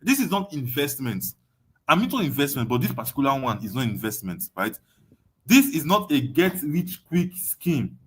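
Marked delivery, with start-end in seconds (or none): none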